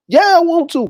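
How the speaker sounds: put-on high-pitched, eager boy; eager yes. Nephew accepts work from uncle, high and eager. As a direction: jump at the offer, pitch way up.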